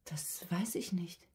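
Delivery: slow, hushed whisper, shutting down; barely audible. Acted. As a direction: whisper it as if you can't bear the question. Withdrawn patient questioned about family. (leise, langsam geflüstert, verschlossen)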